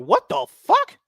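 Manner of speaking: high mock shriek of disbelief; shocked disbelief. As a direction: squeal it in outrage. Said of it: "I'm like".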